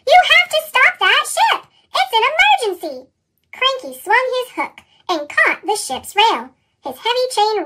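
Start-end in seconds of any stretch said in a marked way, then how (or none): 0.1-3.1 high, louder urgent plea; pitched-up emergency cry; urgent call. A narrator's reading. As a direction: shout a desperate warning. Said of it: "he whistled".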